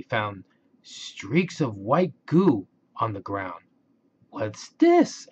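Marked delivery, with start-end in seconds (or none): 4.3-5.3 high, surprised what's this; puzzled question. Acted. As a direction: ask it wide-eyed and much higher. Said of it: "asked Daphne".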